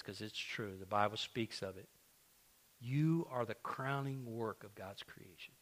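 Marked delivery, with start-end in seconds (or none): 2.8-5.5 soft and slow, drawing them in; hushed, deliberate promise to the church; quiet, slow declaration. Shown as a demonstration. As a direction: let it land gently, almost hushed. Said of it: "repeats the line louder".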